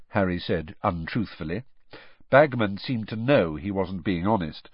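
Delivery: ordinary delivery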